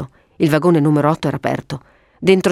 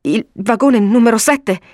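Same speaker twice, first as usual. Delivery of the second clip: higher and slow, mildly shaken; slowed, raised-pitch reply; shaken stammerer; 6 semitones higher, 3 dB louder, slower than usual. A narrator's reading. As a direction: say it slowly, still recovering from the stammer. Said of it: "balbettò".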